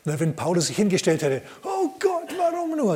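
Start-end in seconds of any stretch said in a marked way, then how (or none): 1.6-3.0 high, whining wail of self-pity; mock whining lament. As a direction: ham up a self-pitying complaint. Paul imagined complaining. (hoch gejammerte Klage)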